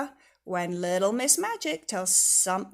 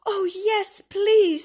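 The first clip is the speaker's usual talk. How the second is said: higher and louder; eager plea; 10 semitones higher, 9 dB louder, much slower than usual. Acted. Mister Tickle begs for his long arms back. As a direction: beg eagerly. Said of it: "said Mister Tickle".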